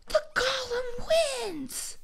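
high, loud, drawn-out victory cry; triumphant shout. Real, not acted. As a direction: proclaim the winner slowly at high pitch. Announcing the winner excitedly.

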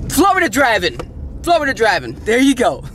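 high, sarcastic call-out; mocking exasperation. Real, not acted. Mocking local drivers.

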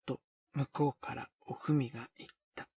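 usual speaking voice

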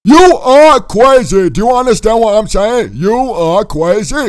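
loud, high exasperated outburst. Acted. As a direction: spell it out, loud and fed up. Confronting the crazy captor.

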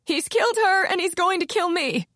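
a frightened wail; terrified witness whining to detectives. A narrator's reading. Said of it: "Ilona Fry whined".

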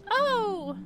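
sudden high surprised oh; startled little gasp of a word. Acted.

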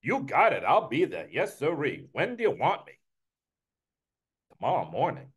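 put-on eager Brooklyn accent with swooping pitch; Uncle Murray. Uncle accepting the executive's invitation, Brooklyn accent. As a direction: agree eagerly, Brooklyn style, then hesitate. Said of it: "Me, I give him a Brooklyn accent".

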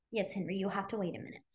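softly and quickly to the side; quiet aside. Real, not acted. Aside to a child mid-lesson.

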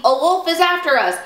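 high, fast cry of alarm. A narrator's reading. Bo Peep warns Little Bear of the wolf, crying out. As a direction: rush it out high and urgent. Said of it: "cried Bo Peep".

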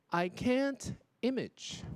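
"imagine" cut to IMAGE, stressed on the first syllable; slow "I can't image", ending without the -in; dropped last syllable. Retold or imitated. Teacher quoting a student's slip, "imagine" mispronounced as "image". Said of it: "我还听到一个说".

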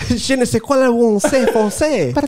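mock French accent, playful. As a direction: put on a silly French accent. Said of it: "you're too good at it".

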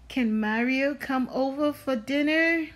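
plain reading voice

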